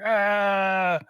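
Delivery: loud, high, held mock roar; long shouted dragon cry; imitated dragon roar. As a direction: roar back like an attacking dragon. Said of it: "And the dragon's like".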